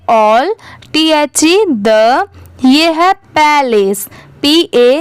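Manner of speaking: ordinary talk, nothing marked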